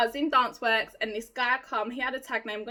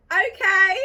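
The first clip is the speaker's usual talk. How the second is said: high, loud bashful okay; giddy shy; 7 semitones higher, 10 dB louder than usual. Retold or imitated. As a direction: squeak out a hammed-up coy yes. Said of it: "Shy, red face".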